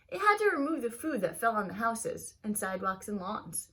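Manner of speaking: lively, expressive storybook reading; reading to children. Acted. Teacher reading a picture book aloud to her students, lively storybook voice. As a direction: read it like story time for a class.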